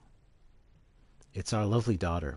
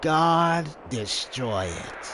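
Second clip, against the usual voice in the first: slow, higher cold command; villain order; 7 semitones higher, 4 dB louder, much slower than usual. Acted. Hojo ordering the camera destroyed, slow and cold. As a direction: sneer the order down slowly.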